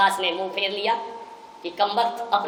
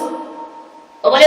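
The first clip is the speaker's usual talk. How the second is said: ordinary delivery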